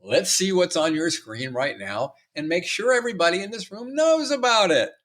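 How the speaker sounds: high, singsong teacher calling out the class; a teacher catching phones in class, mock bright. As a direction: tease the students like a gently sarcastic teacher. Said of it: "I would say".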